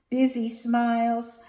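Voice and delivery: lively, sing-song picture book reading; reading to children. A narrator's reading. Teacher reading Bugs at the Beach to kids, sing-song. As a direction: read it like story time.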